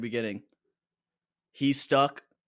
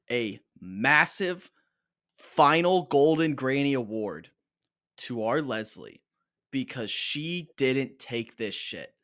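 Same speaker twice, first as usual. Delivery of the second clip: slow mock award announcement; slower than usual.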